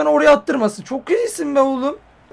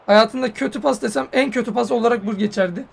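ordinary talk, nothing marked

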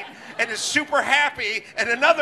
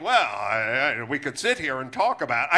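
lower, faster, smooth politician dodge; Gavin Newsom impression; 9 semitones lower than usual. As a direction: brush the question off like a slick governor. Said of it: "And he's like".

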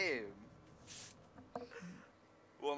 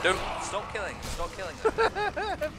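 panicked plea; 9 semitones higher than usual. Real, not acted.

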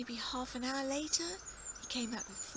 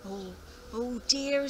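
put-on dismayed old farmer; 6 dB louder, slower than usual.

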